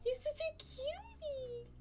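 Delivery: high gushing squeal; high, gushing coo. Real, not acted. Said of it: "I'm gushing".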